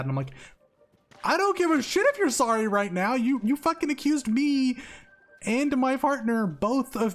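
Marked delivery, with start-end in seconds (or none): 1.2-7.2 high, loud outraged tirade, dragging out the accusation; furious yell. Retold or imitated. To roommate who accused him of theft, furious yelling. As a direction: let him have it, shouting in disbelief. Angry retold confrontation with roommate.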